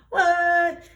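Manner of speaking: high, loud disbelieving cry; stunned high-pitched exclamation; incredulous outburst. Acted. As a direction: blurt it out, shooting up in pitch. Reacting to passed-up opportunities in disbelief.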